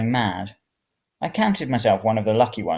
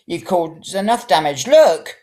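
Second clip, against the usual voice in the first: high, loud accusation; old lady furious at a scratch; accusing old woman; 8 semitones higher, 7 dB louder, slower than usual. A narrator's reading. Old woman accuses him over her scratch, high and loud.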